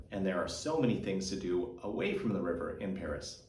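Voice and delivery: ordinary delivery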